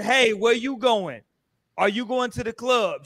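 slow, measured retold pickup line; deliberately calm, drawn-out quote; toned-down retelling. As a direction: retell it slowly and evenly, draining the drama. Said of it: "Why are you trying to change the tone".

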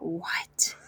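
soft, low, disbelieving what; stunned reaction. Retold or imitated. Wife baffled by husband's borrowed accent, hushed disbelief. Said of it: "And I'm like".

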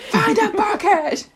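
high mock-frantic yell; dad screaming. Podcaster acting out dad demanding a bucket, mock scream. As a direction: imitate dad yelling urgent orders. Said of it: "my dad starts screaming at me".

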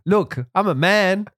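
mock macho boast. Telling wife Carla on the slope he is ready, mock bravado. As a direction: puff up and swagger through it. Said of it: "And it's like".

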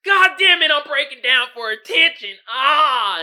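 high, loud mock meltdown; hammed-up mental breakdown, high and loud. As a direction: wail like you're losing it for show. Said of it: "you're like".

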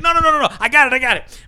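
high, hurried no-no-no; calm him down, overeager; reassuring doctor. Acted. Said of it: "He's like".

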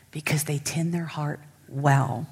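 soft unvoiced whisper; whispered aside to the congregation. Real, not acted. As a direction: let it fall to a whisper. Preacher hushes her point.

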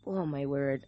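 ordinary delivery

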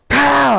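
loud, high, sharp imitated gunshot. Veteran retelling his first shot, loud imitated gunshot. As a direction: bark out the rifle shot. Sniper fires his rifle.

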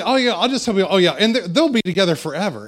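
loud, high and bright as if joking with the church; hammed-up upbeat line to sell the joke; mock cheerful announcement. Husband telling his wife's joke in a mock cheerful voice. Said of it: "and I say".

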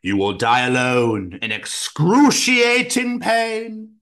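slow, eerie mystic voice; ominous fortune teller. Retold or imitated. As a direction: intone it like a spooky seer. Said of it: "the fortune teller was like".

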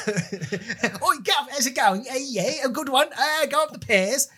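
high-pitched mock Cockney; Cockney chimney sweep. Comedian parodying a chimney sweep, silly high Cockney. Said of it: "not freaking Cockney chimney sweep".

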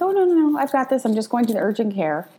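no no no, waving off the fuss; dismissive. Retold or imitated. Mother brushes off help.

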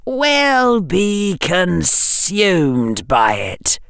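slow, cold, high whisper; icy drawn-out whisper; chill whisper. A narrator's reading. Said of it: "whispered the chill voice".

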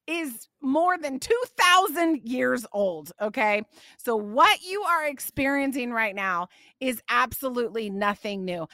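usual speaking voice

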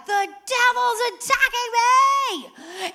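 high, slow, loud panicked cry; panicked yell. Retold or imitated. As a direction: wail that the devil is after you. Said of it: "I immediately was like".

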